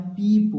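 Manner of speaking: "पीप" high and loud, ee held; long ee vowel. Shown as a demonstration. Teacher modelling double-e for children, long-vowel "पीप". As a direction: lift and stretch the ee in "पीप". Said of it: "बड़ी की मात्रा लगा देंगे".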